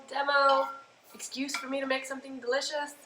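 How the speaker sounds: ordinary talk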